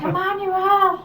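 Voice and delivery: high-pitched, drawn-out mock reproach; imitated exasperated call. Woman calling to Emmanuel on video, mock exasperation. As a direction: call the misbehaving pet by name, mock despairing.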